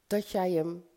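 usual speaking voice

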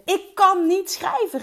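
half-mock loud outburst; shouting it out. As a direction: yell it as if desperate. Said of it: "riep ik heel hard".

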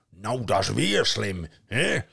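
loud snorting troll voice. A narrator's reading. Troll mocking the gods, snorting loud. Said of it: "snorkte de troll".

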